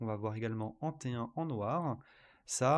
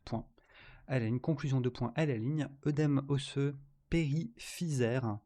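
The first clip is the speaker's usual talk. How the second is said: dictating; 3 dB louder than usual. Shown as a demonstration. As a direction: dictate it clearly, word by word. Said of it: "C'est parti pour la dictée".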